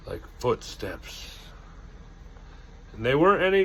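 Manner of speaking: ordinary delivery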